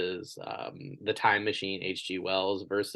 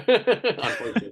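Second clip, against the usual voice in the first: laughing; 13 semitones higher, 7 dB louder than usual. Real, not acted. Laughing at campaign collapse.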